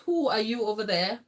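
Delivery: ordinary talk, nothing marked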